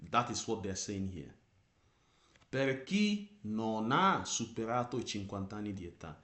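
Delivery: ordinary delivery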